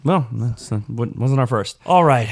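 ordinary talk

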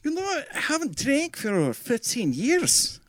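a loose Scottish accent put on as Craig Ferguson; Craig Ferguson impression. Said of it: "I can't do that accent".

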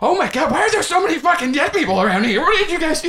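high-pitched frantic disbelief; hammed-up shock, voice climbing high; [name], aghast. [name] reacts to the dead bodies.

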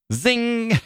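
very high-pitched, ringing zing; playful high "zing"; voiced exclamation marks. Shown as a demonstration. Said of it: "That's how I hear it in my head".

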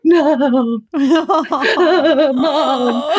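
put-on drawn-out childlike wail dissolving into laughter; mock wailing. Injured daughter calls to her mom, mock wailing. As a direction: imitate whining for mom, laughing.

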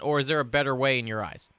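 ordinary delivery

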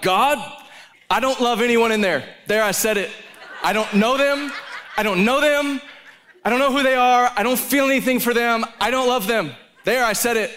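high, laughing outburst, getting it off his chest; half-laughing rant pitched high; exasperated confession with a laugh; blurted confession. Retold or imitated. Blurting honest confession to God.

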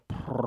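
low, soft mock purr; a short low purr; imitated purr. Retelling her mid-act purr, soft mock purr. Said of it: "pick her head up and then go".

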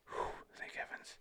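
whispered relief; relieved sigh. A narrator's reading. Moses relieved hand restored, whispered sigh. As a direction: breathe it out in relief. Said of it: "And he sighed".

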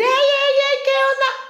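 high, loud mock yelled greeting; belting out a brash hello like the office shouter; imitating a loud shout. Mimicking the loud coworker who greets everyone yelling. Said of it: "Gritar para mí es una voz muy alta".

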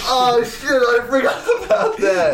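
high-pitched, laughing surprise; surprised realisation. Real, not acted. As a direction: blurt it out high through a laugh. Suddenly reminded of forgotten show.

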